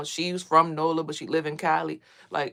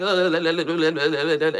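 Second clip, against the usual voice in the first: louder rhythmic choking grunts; possessed grunting; 5 dB louder than usual. Retold or imitated. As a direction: grunt as if something took over your body. Possessed in a dream, retold.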